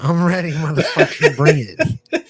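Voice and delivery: playful, laughing challenge; laughing bravado. Pretend. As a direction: egg them on through laughter. Joking readiness for teasing question.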